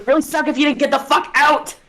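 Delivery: high, loud furious shout; angry elf. Acted. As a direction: yell at intruders to clear off. Angry elf answers the pleading players.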